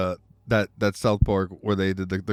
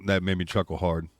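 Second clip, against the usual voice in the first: ordinary talk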